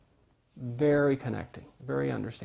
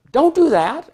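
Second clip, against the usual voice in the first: high, loud scold; scolding parent; 12 semitones higher, 8 dB louder than usual. Retold or imitated. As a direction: snap it like a cross parent.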